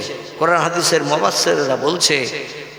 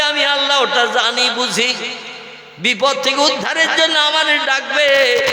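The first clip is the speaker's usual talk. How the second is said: preaching at full force; 9 semitones higher than usual. Acted. Preacher voicing God's command.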